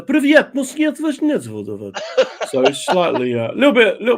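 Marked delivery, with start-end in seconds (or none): 0.0-1.4 much higher mock foreign accent, laughing; mock European accent. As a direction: ham up a silly European greeting. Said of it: "they would say".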